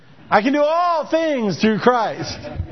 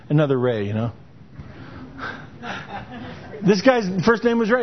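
ordinary talk, nothing marked

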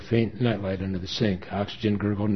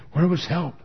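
desperate question; 8 semitones higher, 3 dB louder than usual. A narrator's reading. Nurse desperate during resuscitation.

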